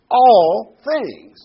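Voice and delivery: ALL things, ALL hit hard, high and loud; stress on all things. Shown as a demonstration. Preacher stressing All things to the congregation, emphatic. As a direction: hammer the word All in All things. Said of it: "I want to emphasize two words".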